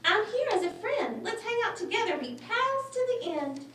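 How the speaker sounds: high, timid mouse voice; squeaky little mouse. Acted. Little mouse nervously asking the lion for help, squeaky. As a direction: ask politely in a tiny squeak.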